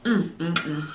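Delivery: ordinary talk, nothing marked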